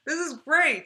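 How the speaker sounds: high, loud, laughing joy; happy laughing cheer; delighted laughing. Real, not acted. Reactor laughing with delight at the vlogger.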